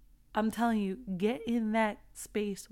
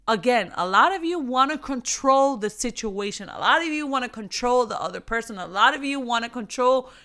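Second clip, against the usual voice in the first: louder preaching; 3 semitones higher, 9 dB louder, faster than usual. Acted. Preaching with force.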